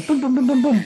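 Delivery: playful falling-object noises; imitated thudding. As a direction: mimic pieces tumbling out, amused. Imitates pieces of mug dropping.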